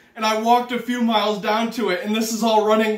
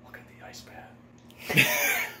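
normal talking voice

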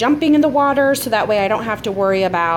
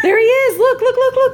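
high, loud, laughing delight; excited shout; 14 semitones higher, 6 dB louder than usual. Real, not acted. Bubble toy appears, mom delighted.

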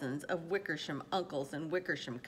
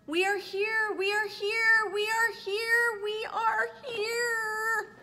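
high, loud chant, last one drawn out; fearful crowd cry; 13 semitones higher, 6 dB louder, slower than usual. A narrator's reading. Whos crying out in fear to be heard, shrieked chant. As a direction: cry it out desperately in a tiny high voice. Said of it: "his people cried loudly, they cried out in fear".

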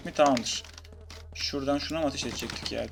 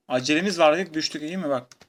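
usual speaking voice

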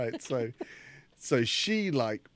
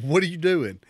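raised, louder challenge, higher pitch; calling someone out; 7 semitones higher, 7 dB louder than usual. Retold or imitated. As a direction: call her out loud, retelling it. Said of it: "I called her ass out".

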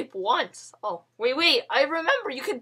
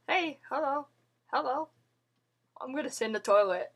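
ordinary delivery

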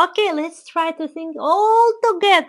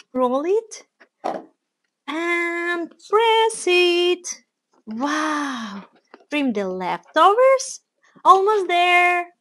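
put-on lightly sing-song voice for little kids; reading to a toddler; 4 semitones higher, slower than usual. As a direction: talk gently to a toddler. Play-Doh play for toddlers.

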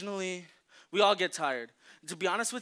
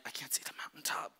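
breathless whisper; whispered to self; 8 dB quieter than usual. Retold or imitated. To himself on the endless climb, whispering. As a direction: whisper it, half-defeated.

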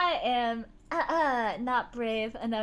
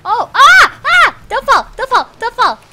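much louder, higher screams and pleas; panicked screaming; 9 semitones higher, 18 dB louder, slower than usual. Real, not acted. Streamer begging Lara not to fall, screaming in panic. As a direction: scream as she slips. Character falling.

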